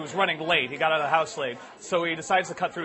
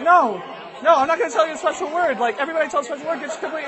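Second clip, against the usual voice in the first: high, rushed refusal; panicky child; 8 semitones higher, faster than usual. Acted. Boy refuses to tell mom, panicky. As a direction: refuse in a fast kid voice. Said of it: "And he's like".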